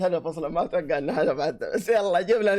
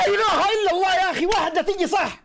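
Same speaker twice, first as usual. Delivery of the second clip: frustrated outcry; 13 semitones higher, 3 dB louder than usual. Real, not acted. Frustrated at a missed chance.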